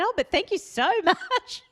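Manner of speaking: high, drawn-out effusive gratitude; exaggerated high thank-you; gushing thanks. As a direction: gush to smooth things over. Gushing apology message to the lady.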